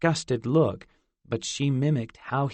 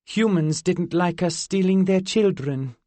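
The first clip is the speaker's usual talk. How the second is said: put-on high, curious fairy girl; 7 semitones higher, 4 dB louder than usual. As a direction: voice her higher, young and otherworldly. Zinnia answering matter-of-factly.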